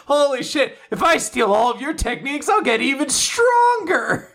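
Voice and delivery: high, eager voice of a thug who just found a shortcut; greedy excitement. Retold or imitated. Fang gloating over the beaten master, high and eager. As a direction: squeal it out like greed just clicked. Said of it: "He's like".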